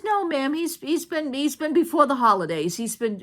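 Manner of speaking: stammering, caught-out employee voice; a nervous clerk scrambling for an excuse; flustered clerk. Retold or imitated. Retelling clerk's flustered reply.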